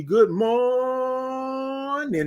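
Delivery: slow, high stretched "Good morning"; singsong stretched "Good morning"; drawn-out good morning. Retold or imitated. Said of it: "They said it just like that".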